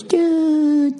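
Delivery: very high drawn-out dude; squeaky bro greeting; dude impression. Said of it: "everybody just goes".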